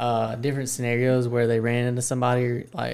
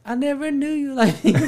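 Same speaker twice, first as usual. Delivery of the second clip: surprised husband; 11 semitones higher than usual. Retold or imitated. Husband's line in the song story.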